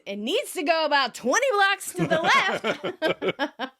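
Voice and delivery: high, drawn-out mock shout; slow, hammed-up retold yell. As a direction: stretch out an exasperated retort. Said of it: "Monica shouts".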